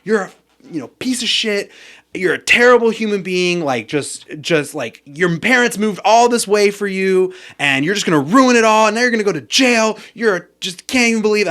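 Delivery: mock scolding rant, leaning hard on key words; retold cussing out. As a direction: act out his berating, pitch climbing high on the accusations. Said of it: "cussing me out".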